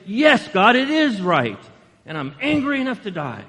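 loud, high, petulant insistence; Jonah arguing with God. Retold or imitated. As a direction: plead your case to God with a sulk. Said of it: "He's talking to God".

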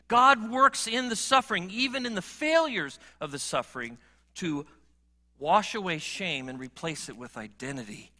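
ordinary talk, nothing marked

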